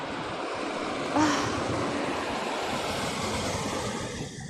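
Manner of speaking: long breathy exhausted sigh; long weary sigh. Real, not acted. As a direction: let out a tired sigh. Tired, feet hurt.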